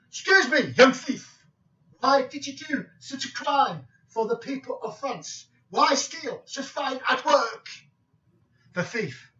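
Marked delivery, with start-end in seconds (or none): 0.1-7.7 high, loud mock-French detective; indignant scolding in a hammed-up French accent. Famous detective scolding a young thief, loud French accent. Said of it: "said in his French accent".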